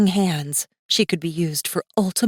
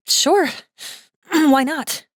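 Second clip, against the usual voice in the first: put-on higher, louder, casual young woman; breezy girl; 10 semitones higher, 4 dB louder, much slower than usual. Girl shrugging off Masaaki's doubt, high and casual. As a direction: toss it off lightly.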